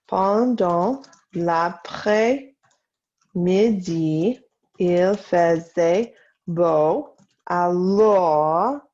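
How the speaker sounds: slow French with pauses between words; slow dictation. Shown as a demonstration. Teacher to class copying about the afternoon, slow dictation. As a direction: dictate word by word, slowly.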